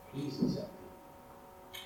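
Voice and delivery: slow, hushed whisper; a sinner pleading to Jesus, whispered; whispered plea. Acted.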